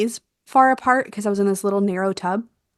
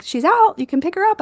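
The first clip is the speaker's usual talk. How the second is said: much higher, bright announcement from the midwife; voicing the midwife; 10 semitones higher, 3 dB louder than usual. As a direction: play the midwife calling the birth, higher. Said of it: "My midwife was like".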